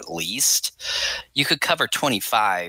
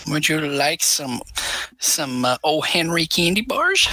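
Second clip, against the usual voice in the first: put-on higher old codger offering candy; old prospector; 6 semitones higher than usual. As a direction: play a kindly old prospector. Voicing an old man offering candy.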